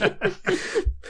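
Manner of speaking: high, breathless laugh; unvoiced, helpless giggle; soft laugh that squeaks upward. Real, not acted. Laughs after dismissing the flukes.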